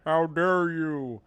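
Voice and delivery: slow drawn-out zombie groan. Acted. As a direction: stretch dare like a zombie. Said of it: "That's my zombie voice".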